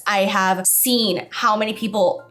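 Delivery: normal talking voice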